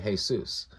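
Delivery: "Jesus" as hay-SOOS; "Jesus" with an h for the J, soos ending; Spanish pronunciation. Shown as a demonstration. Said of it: "like the Spanish-speaking".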